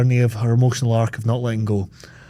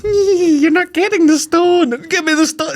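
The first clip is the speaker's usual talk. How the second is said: very high, slow giggly taunt; giggling Rose; 3 dB louder, slower than usual. Retold or imitated. Mocking Rose taunting the man over the stone, high and giggly. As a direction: tease it out slowly like a giggling child. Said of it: "her giggling".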